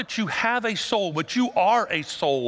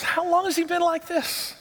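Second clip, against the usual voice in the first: tender high question; 11 semitones higher than usual. Retold or imitated. Preacher voices Jesus' tender question.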